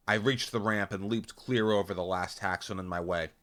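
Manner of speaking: plain reading voice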